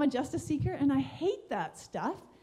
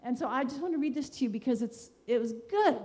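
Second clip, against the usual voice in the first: ordinary talk, nothing marked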